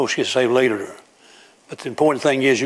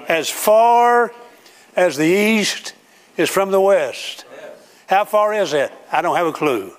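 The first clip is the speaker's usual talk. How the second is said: rising preaching; 7 semitones higher than usual. Acted. Preaching climax, raised voice.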